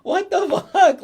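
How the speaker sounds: high, drawn-out incredulous outburst; mock disbelief. Host reacting to the villain's bike prank, mock disbelief. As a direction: squeal it slowly in comic disbelief.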